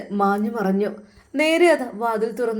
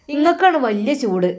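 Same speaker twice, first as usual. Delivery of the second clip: loud, higher angry retort; 4 semitones higher, 7 dB louder than usual. A narrator's reading. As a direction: throw it back at him. Stepmother retorts angrily.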